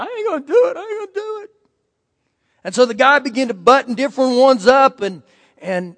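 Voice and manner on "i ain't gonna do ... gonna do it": higher, fading, fearful voice; mock a panicked man backing off, trailing away; scared refusal. Frightened men on the tower refusing to jump, higher and fearful.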